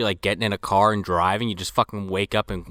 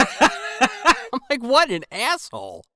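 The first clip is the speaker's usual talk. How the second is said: through a laugh, higher; laughing quote; 12 semitones higher, slower than usual. Real, not acted. Podcaster laughs retelling his reaction, higher. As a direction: still laughing at the weeb, higher. Laughing while quoting himself.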